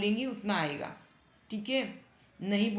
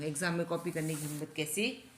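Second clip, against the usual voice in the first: ordinary talk, nothing marked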